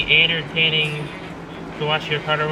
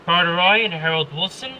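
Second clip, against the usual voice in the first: usual speaking voice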